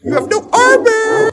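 high, loud, drawn-out taunt; taunting yell. Real, not acted. Taunting an opponent in game.

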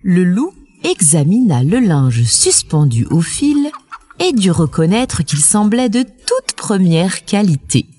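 plain reading voice

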